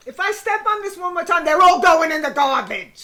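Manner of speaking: a hint of a fed-up mom; threatening mother. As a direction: threaten to bin the toys. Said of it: "my mother would have said".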